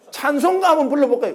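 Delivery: high, eager offer to sing; father hamming it up, keen to sing; overexcited father. Retold or imitated. Father eagerly offering the pastor's wife a hymn, high and keen. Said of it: "또 한번 오버하거든".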